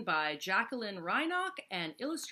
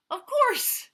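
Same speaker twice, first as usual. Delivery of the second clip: giggling; 12 dB louder than usual. Acted. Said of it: "he giggled".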